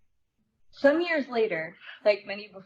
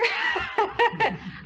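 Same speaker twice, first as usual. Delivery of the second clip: high bright laughter; real laugh; 13 semitones higher than usual. Real, not acted. After saying she is no actor, a high real laugh. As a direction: laugh it off, embarrassed.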